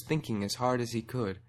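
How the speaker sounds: plain reading voice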